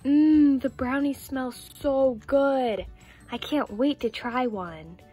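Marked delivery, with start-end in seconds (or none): 0.0-2.9 loud drawn-out mmm, then slow relish; savoring delight. Acted. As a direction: savor the smell, stretching the words out. Savoring smell of fresh brownies.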